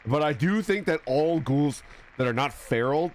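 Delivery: ordinary delivery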